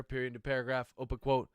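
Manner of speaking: reading aloud, speaking the punctuation; dictating. Acted. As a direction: dictate it, naming every comma and period.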